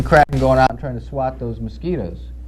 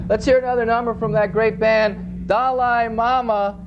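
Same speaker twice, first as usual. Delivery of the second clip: higher, slow drawn-out "Dalai Mama"; band intro; 7 semitones higher, slower than usual. Acted. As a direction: bring the band on like a showman.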